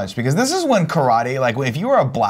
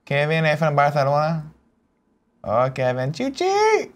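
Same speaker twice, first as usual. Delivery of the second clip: playful fake Spanish accent, slightly raised; mock Spanish accent; 4 semitones higher, slower than usual. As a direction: tease in a hammed-up Spanish accent. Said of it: "can you do a Spanish accent".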